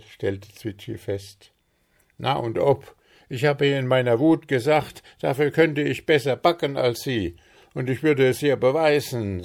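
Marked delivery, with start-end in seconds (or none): none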